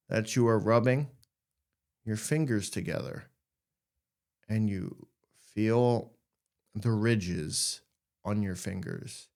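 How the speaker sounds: walk them gently through noticing their fingers; guided exercise. Acted. Leads a sensory exercise.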